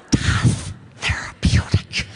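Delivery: a breathy hushed aside; whispered aside. Acted. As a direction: whisper it like a secret.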